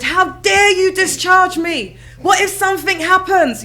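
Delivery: high, loud, slow mock outrage with a laugh; shocked outrage. Phoning the secretary, loud laughing mock outrage. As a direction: play the indignant patient, half laughing. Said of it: "I was just so shocked".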